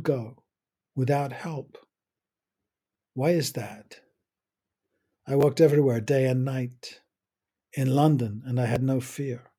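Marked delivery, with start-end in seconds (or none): none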